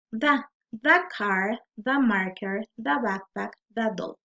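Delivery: "the" with an open ah vowel; "the" pronounced "da". Shown as a demonstration. Teacher listing consonant-sound examples, each "the" said "da". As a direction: say each "the" as "da", never "thee". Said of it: "se va a pronunciar como da".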